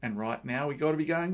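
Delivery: normal talking voice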